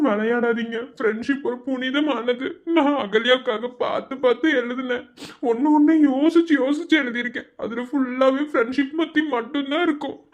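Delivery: a nervous junior begging the senior; pleading timid student. Acted. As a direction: plead meekly to get the letter back.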